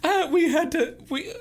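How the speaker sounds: high falsetto, stammering start; earnest high-pitched character voice; shy twin. Acted. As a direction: explain nervously in a squeaky voice. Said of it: "she says, uh".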